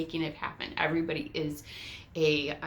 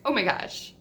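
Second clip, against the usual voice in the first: louder, elated; delighted gasp; 4 semitones higher, 8 dB louder than usual. Retold or imitated. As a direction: exclaim it with joy. Glowing realization.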